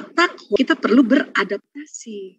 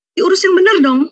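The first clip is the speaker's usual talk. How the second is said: higher, louder mock scold; nagging mother-in-law; 8 semitones higher, 5 dB louder than usual. Tired mother-in-law scolding daughter-in-law, mock nagging. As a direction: nag about proper care.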